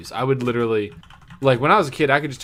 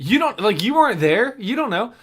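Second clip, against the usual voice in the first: mock indignant; 11 semitones higher, 8 dB louder than usual. Real, not acted. Playful mock protest.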